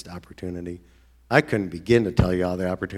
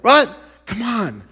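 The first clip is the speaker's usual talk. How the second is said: very high, loud what then come on; shocked disbelief; 14 semitones higher, 9 dB louder, slower than usual. Retold or imitated. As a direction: yelp it in disbelief. His own shocked disbelief.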